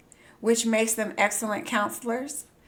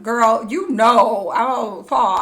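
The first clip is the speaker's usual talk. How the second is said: sassy aside; 7 dB louder, slower than usual. Real, not acted. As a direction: lean in, sassy and slow. Chatting to friend about upgrade.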